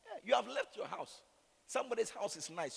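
ordinary talk

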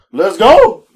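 loud, rising cheer of GO; excited let's go. Retold or imitated. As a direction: jump at the fare with a loud cheer. Excited ride offer quoted.

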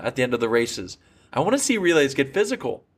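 ordinary talk, nothing marked

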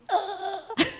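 high, reluctant groan; a shy self shrinking back; mock reluctant ugh; shy [name]. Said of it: "that was the shy [name]".

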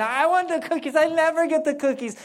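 put-on fed-up child whining about cookies; whiny kid. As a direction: play the kid begging for cookies. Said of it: "your kids are complaining".

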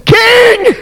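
high shouted plea; desperate cry. Acted. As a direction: call up to the king on the wall. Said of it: "She cried out".

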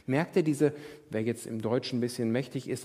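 ordinary talk, nothing marked

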